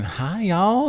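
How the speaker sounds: high-pitched mock Southern greeting; imitated Southern accent. Husband imitating his wife visiting relatives, Southern accent. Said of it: "just get this accent thing going on".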